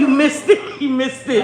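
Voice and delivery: high, loud burst; loud exclaiming. Real, not acted. Mom to son about the cut text, loud exclaiming. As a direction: call it out loudly across the room.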